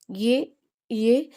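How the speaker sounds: slow, drawn-out questioning stammer; stunned disbelief. A narrator's reading. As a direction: stretch each word into a stunned question. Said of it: "सहर ने हक्का बक्का होकर".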